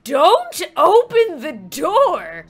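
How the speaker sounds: loud, slow drawn-out shout; stretched, forceful command; desperate warning. Acted. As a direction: shout it word by word as a frantic mom. Mom repeats warning, desperate.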